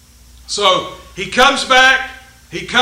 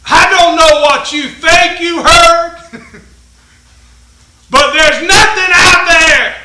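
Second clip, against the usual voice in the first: loud, higher retold shout to Elijah; servant shouting back; 6 semitones higher, 9 dB louder than usual. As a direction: call it out insistently. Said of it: "the guy came back and said".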